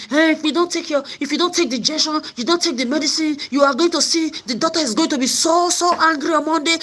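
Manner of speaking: high voiced warning nurse.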